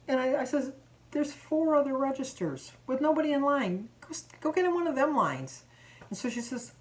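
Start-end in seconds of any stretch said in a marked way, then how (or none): 1.1-5.6 higher-pitched, exasperated pushback; annoyed retort. Retold or imitated. Shopper points rude customer to empty registers, annoyed. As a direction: retell telling someone off.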